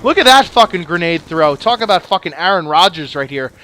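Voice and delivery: normal talking voice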